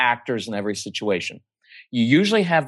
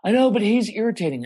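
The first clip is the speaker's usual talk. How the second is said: put-on higher, whiny kid protest; whiny tattling little sibling; 9 semitones higher than usual. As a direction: whine back at mom. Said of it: "kid's reply".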